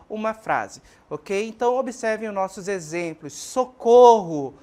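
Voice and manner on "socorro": slow, loud call of socorro; cry for help. Shown as a demonstration. Teacher demonstrating a cry for help, calling out. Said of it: "este pedido de ajuda".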